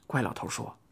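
ordinary delivery

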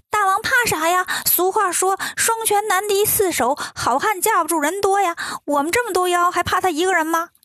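high-pitched, brash imp chorus; squeaky minions goading their boss; cocky little imps. A narrator's reading. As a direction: pipe up like cheeky little demons. Little imps egging on king.

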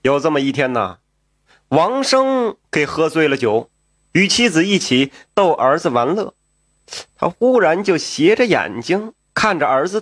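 ordinary delivery, nothing marked

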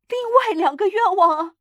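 high, loud, excited answer; quick eager reply. A narrator's reading. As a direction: answer at once, urgently and high. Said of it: "他很快地回答".

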